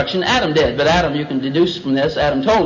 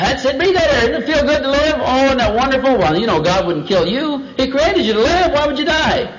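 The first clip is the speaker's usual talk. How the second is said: high, gushing reassurance; hammed-up tempter selling life; coaxing serpent; 9 semitones higher than usual. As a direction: lure the listener with put-on delight. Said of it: "He just kind of toyed with it".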